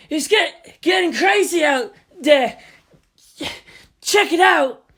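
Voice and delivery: loud, high urgent calling; excited breathless shout. Acted. Artist rushing in to the crew, breathless excited shout.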